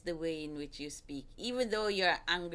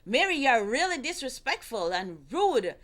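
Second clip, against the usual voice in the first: louder, higher accusing husband; angry accusing; 4 semitones higher, 6 dB louder than usual. Shown as a demonstration. Wife shows how a husband should not talk to Mary. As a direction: snap at her like the wrong way to do it. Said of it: "you never cross as like".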